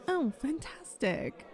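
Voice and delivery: ordinary talk, nothing marked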